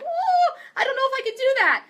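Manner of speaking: high, loud mock-daunted squeal; exaggerated high-pitched "ooh" of hesitation; imitated nervous doubt. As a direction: squeal it fast, imitating someone intimidated. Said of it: "something about me that's like".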